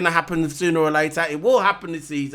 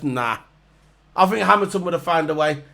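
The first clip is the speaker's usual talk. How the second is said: usual speaking voice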